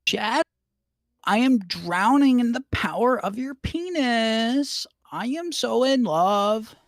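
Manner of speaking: high, slow, breathless swoon; swooning girl voice. Acted. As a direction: gush it out like a lovestruck girl. Co-reader voices Cream swooning.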